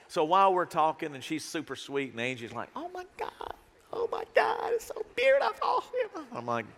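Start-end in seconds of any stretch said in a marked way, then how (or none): 2.8-6.2 shrieking with delight, laughing; gushing squeal. Retold or imitated. Angie gushing, voiced in a story.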